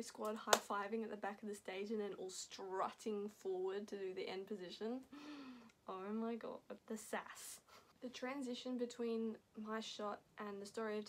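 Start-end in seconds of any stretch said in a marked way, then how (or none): 5.0-5.7 a quick, soft surprised gasp; sharp gasp. Real, not acted. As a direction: gasp in awe. Reacting to the stage finale.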